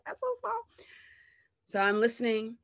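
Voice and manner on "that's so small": high mock listener voice, very high. Mocking the listener who finds the story small, pitched very high. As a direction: play the unimpressed listener, way up high. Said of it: "You're gonna be like".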